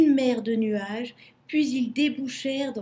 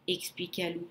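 ordinary delivery, nothing marked

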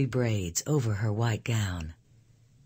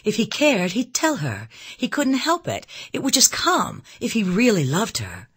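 put-on high-pitched, insistent young woman's outburst; impassioned cry; 8 semitones higher, 3 dB louder than usual. A narrator's reading. Elizabeth disputing that he loves Anne, crying out. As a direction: protest with rising passion, pitched well up. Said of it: "she cried".

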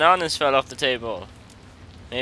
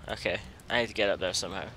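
ordinary delivery

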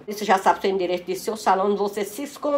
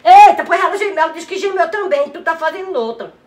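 high, raised-voice outburst; loud exclamation; 7 semitones higher, 9 dB louder than usual. Real, not acted. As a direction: burst out loudly on catching someone at it. Reage exaltada ao gemido ouvido. (exclamação exaltada, voz aguda)

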